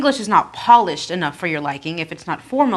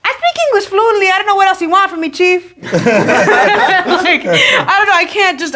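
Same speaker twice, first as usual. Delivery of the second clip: dad fed up, much higher and louder; exasperated dad; 12 semitones higher, 8 dB louder than usual. Retold or imitated. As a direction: fire it back like an exasperated dad, fast. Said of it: "And he's like".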